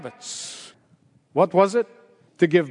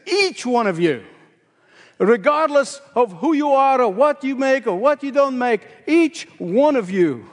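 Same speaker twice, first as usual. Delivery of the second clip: "Each one of you" stressed on "Each", the middle raised; emphatic each; 5 semitones higher than usual. Shown as a demonstration. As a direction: press "Each" both times, lift the middle. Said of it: "Each is in emphatic position".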